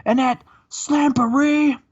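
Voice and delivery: high, loud falsetto wrestler promo, partly whispered; Scott Steiner promo. Retold or imitated. Host imitates Scott Steiner cutting a promo on the mic, high. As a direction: ham up a strained, high wrestling boast. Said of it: "He looks and says".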